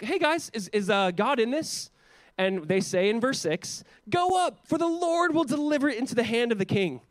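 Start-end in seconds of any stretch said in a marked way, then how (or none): 0.0-1.9 high-pitched, casual question to the group; casual asking. Retold or imitated. Ahab asking his four hundred prophets about God, casual asking. 4.1-7.0 high, ringing mock-prophetic cry; theatrical proclamation. Prophets telling the king to go up, theatrical proclamation.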